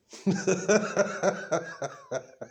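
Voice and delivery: high bursting laugh; burst of laughter. Real, not acted. Laughing at the absurd court papers. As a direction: crack up at your own joke.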